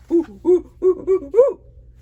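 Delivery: loud high hooting laugh; a happy gorilla hoot. Acted. Reading the flannel board, gorilla calling out, loud hoot.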